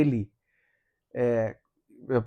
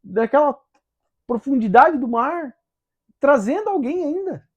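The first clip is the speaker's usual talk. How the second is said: amazed disbelief; 10 semitones higher, 5 dB louder than usual. Retold or imitated. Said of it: "Aí ele falou assim".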